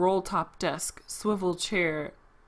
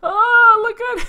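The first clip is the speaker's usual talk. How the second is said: amused exclaim; 13 dB louder, slower than usual. Real, not acted. Laughing at silly character.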